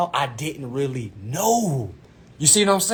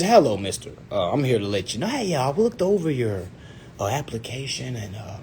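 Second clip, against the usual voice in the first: soft, lower, polite customer-service voice; polite advisor; 5 semitones lower, 7 dB quieter than usual. Retold or imitated. Financial advisor calmly declining an application, politely. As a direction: let them down gently, with hesitant pauses. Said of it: "she said it so politely".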